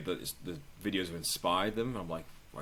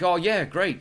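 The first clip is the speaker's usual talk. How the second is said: eager tour guide; 9 semitones higher, 8 dB louder, slower than usual. Retold or imitated. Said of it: "were like".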